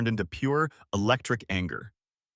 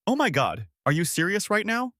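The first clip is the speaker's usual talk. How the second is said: snapping; 9 semitones higher than usual. Acted. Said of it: "she snapped".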